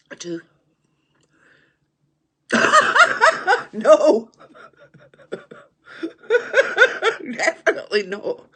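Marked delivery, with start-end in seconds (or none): 2.5-8.5 high shrieking laughter around a loud no; laughing denial. Real, not acted. Denying a cheeky viewer suggestion, shrieking with laughter. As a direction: cackle and shout no through the laugh.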